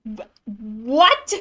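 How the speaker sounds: stammered, then sharply rising question; real startled double-take; stunned disbelief. Real, not acted. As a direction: blurt it out, baffled, swooping up at the end. Streamer reacts to revealed power.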